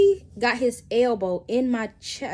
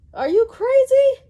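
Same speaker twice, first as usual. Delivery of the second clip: very high, loud shocked shriek; shocked yell; 10 dB louder than usual. Retold or imitated. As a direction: scream it at him in disbelief. Stopped car, she confronts him.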